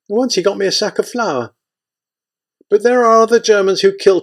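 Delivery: put-on old aunt Christia. A narrator's reading. Old woman Christia telling her lodger.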